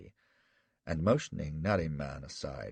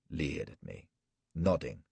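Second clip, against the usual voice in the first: ordinary delivery, nothing marked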